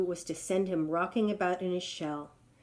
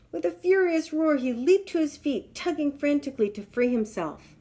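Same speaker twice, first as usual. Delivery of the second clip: excited narration; 7 semitones higher, 5 dB louder than usual. A narrator's reading. Narrating lion's pain.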